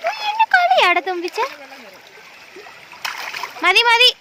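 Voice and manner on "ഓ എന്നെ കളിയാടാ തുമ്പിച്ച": playful mock complaint. Real, not acted. Parent to splashing toddler, playful high-pitched complaint. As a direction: swoop up high, then drop, teasing.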